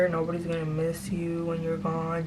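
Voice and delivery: ordinary talk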